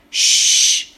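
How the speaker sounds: sharp whispered shush; the donkey shushing the piglet; hushing. Acted. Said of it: "risponde piano l'asino". (zittire sussurrando)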